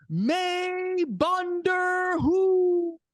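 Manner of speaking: high, very slow, stretched-out arena call; announcer proudly shouting Punjabi to the crowd, stretched long; drawn-out announcer call. Retold or imitated. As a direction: hold every syllable like a ring introduction. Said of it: "I say it again in the microphone".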